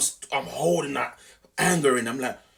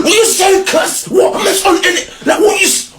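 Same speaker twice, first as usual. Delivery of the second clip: high, loud street challenge yelled out; angry shout; 17 dB louder than usual. Retold or imitated. Shouting down the rude bus driver, squaring up for a fight. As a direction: yell it like you're squaring up. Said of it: "my way of not getting, like, in that zone".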